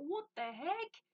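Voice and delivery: puzzled surprise. Retold or imitated. Recalling opening the door to a forgotten parcel, puzzled surprise. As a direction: act out a baffled double take. Acts out thought on finding parcel.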